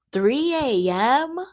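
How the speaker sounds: mock amazement at a viewer; surprised. Real, not acted. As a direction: gasp at the late hour.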